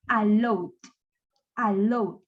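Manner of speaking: "allowed" with just a D closing it; "allowed" in two syllables, no extra -ed syllable; D-only ending. Shown as a demonstration. Teacher models how to pronounce the verb, ending straight on D. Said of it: "vamos a pronunciar al verbo como".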